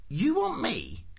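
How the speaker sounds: high, incredulous official; spelling it out. A narrator's reading. Prosser works out Ford's strange request, high and incredulous. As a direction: puzzle it out in a high voice. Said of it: "spelling out this new thought to himself".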